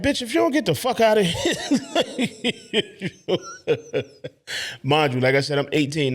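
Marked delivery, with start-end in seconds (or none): 0.0-1.5 high, laughing mock outrage; laughing disbelief. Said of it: "I'm like". 2.7-4.8 hearty laugh. Real, not acted. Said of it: "laughs after the retold line".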